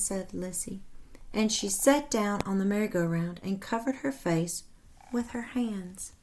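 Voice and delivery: lively read-aloud storytelling voice; animated storybook narration for children; story narration. A narrator's reading. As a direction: read it like a picture book to kids. Reads story aloud to class.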